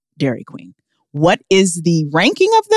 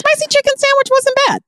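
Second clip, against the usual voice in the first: gushing. Real, not acted. Gushing over sandwich.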